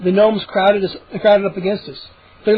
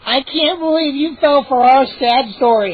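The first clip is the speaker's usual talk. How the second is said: higher, gloating gnome voice; mocking gnome; 6 semitones higher, 3 dB louder than usual. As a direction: gloat over the trick. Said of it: "Hap said, shaking his head".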